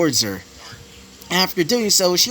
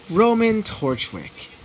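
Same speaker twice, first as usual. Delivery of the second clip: plain reading voice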